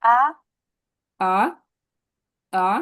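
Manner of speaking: আ sliding upward; second-tone আ rising. Shown as a demonstration. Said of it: "ভয়েসটা কি উপরে যাবে এটা".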